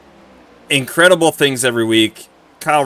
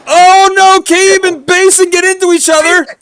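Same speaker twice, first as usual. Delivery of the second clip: high, loud race call; excited announcer; 14 semitones higher, 6 dB louder than usual. Real, not acted. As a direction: scream the wreck like a play-by-play announcer. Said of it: "Unbelievable announcer voice right now".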